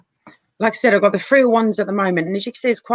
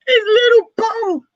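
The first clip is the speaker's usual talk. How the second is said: laughing coo; 5 dB louder, slower than usual. Real, not acted. Cooing over crocheted figure's bum.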